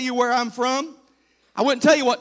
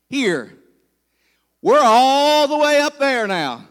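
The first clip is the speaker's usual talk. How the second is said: ordinary talk, nothing marked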